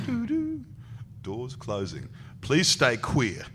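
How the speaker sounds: sung chime, then slow announcer parody; playful door warning, lifting and slowing at the end; mock lift announcement. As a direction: imitate a lift voice, drawing out the punchline. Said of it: "He goes".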